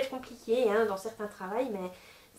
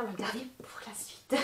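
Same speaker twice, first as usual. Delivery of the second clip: teasing whisper; 11 dB quieter than usual. Real, not acted. Teasing viewers with hidden card.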